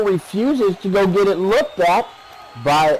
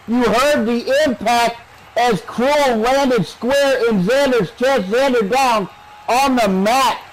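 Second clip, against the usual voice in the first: raised, punchy wrestling commentary; live play-by-play; 6 semitones higher than usual. Real, not acted. Announcer describing a shoulder block, animated play-by-play. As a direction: call the collision as it lands.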